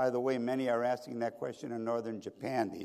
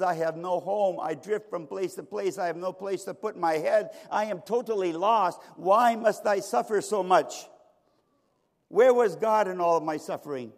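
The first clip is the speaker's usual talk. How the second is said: higher, louder plea of a man who lost everything; anguished husband protesting; 5 semitones higher, 5 dB louder than usual. Acted. Homeless husband on the witness stand questions God, anguished protest.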